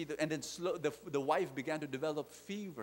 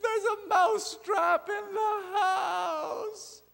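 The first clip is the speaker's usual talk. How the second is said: shrill, crying mouse shout, slow and much higher; tiny mouse crying out for help, much higher and louder; frantic mouse; 9 dB louder, much slower than usual. Acted. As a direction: wail the warning like a desperate little mouse. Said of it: "the mouse goes to his friends".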